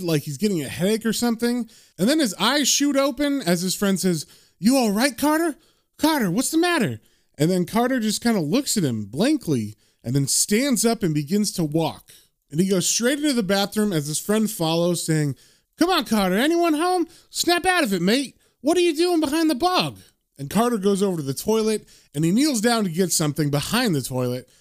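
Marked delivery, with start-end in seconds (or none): 4.6-7.0 higher-pitched, worried questioning; mildly alarmed acted voice; concerned friend. Friend worried as Carter zones out. 15.8-20.0 high-pitched, insistent questioning; raised-pitch urgent coaxing; puzzled friend calling. Acted. Friend follows dazed Carter, trying to rouse him.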